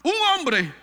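high, loud accusing cry; Demetrio shouting. Retold or imitated. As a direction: yell to stir up the crowd. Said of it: "Demetrio gritó".

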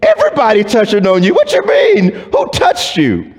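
Peter rushing, then cracking high in disbelief; a hammed-up "are you serious" to his master; Peter, amazed that Jesus asks who touched him; incredulous Peter. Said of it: "And Peter says".

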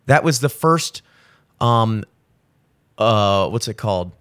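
groping for the word; halting, searching for the word; blanking on lines. Real, not acted. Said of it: "completely blanking right now".

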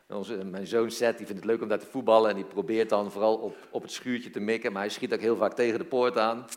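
ordinary delivery

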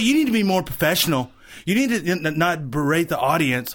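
high, quick mock scolding; imitated yelling. Comic imitating heckling couple yelling at him. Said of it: "her boyfriend are yelling at me".

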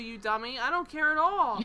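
emphatic retold reassurance. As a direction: brush off the apology emphatically, imitating him. Same retold reassurance continues.

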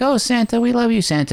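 put-on high excited child voice; adoring kids. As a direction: gush over Santa like a little kid. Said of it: "the children surround him, like".

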